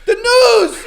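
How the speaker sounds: high, loud mock yell; shouted imitation with laughter; imitated scream. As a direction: holler it like an overhyped fan. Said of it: "And Frankie's screaming".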